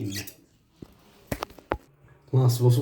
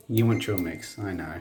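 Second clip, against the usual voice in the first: ordinary delivery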